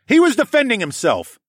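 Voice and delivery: high, loud defensive shout. A narrator's reading. Parent shielding their son, a shout. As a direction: shout down the accusation. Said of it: "I shouted again".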